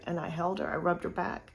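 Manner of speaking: ordinary talk, nothing marked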